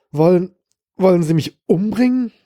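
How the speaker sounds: terrified man in the tub stammers at his killer, frightened; tense, frightened. A narrator's reading. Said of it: "wirkte dadurch nur noch angespannter".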